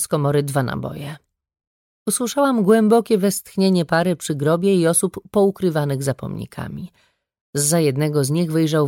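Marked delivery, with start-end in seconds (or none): none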